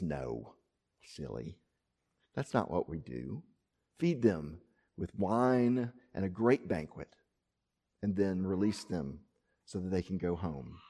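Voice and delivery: gentle quiet. A narrator's reading. Elisha gently refuses to kill captives.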